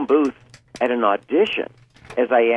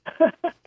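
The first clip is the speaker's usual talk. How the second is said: high giggle; 5 dB quieter than usual. Real, not acted. Laughs after the demo.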